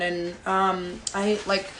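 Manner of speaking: flat, quiet monotone delivery. Shown as a demonstration. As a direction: drone it out in a monotone. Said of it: "talked like this".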